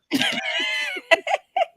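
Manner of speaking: high-pitched burst of laughing; real laughter. Real, not acted. Mother amused by her daughter's idea, real high laugh.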